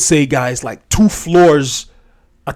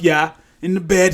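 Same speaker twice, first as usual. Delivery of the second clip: ordinary delivery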